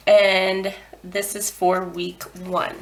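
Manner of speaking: ordinary talk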